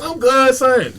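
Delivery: high-pitched, strained claim to be fine; mock crying. Struggling man insisting he's fine, high mock tearful voice. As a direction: put on a high voice, hiding tears. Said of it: "And he's crying".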